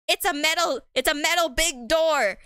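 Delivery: hammed-up frustrated plea; acted exasperation. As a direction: play someone desperate to get out. Character trapped at metal door.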